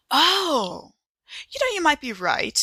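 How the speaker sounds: high, sudden Oh of discovery; surprised realization. Acted. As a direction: gasp it as it clicks into place.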